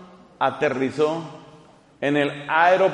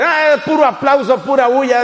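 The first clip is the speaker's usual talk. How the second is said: mocking voice; 7 semitones higher, 6 dB louder than usual. Mocking the charismatics.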